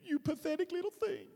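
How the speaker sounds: high, cooing fake sympathy; syrupy put-on condolence; mock pity. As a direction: coo over them as if over a baby. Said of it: "It's not like he goes".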